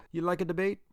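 Italian grandma questioning her grandson; thick Italian accent. A narrator's reading. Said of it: "in her thick accent".

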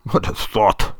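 put-on German-accented scoff. A narrator's reading. Director dismissing Mister Lopat's idea, German accent. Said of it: "he says in his German accent".

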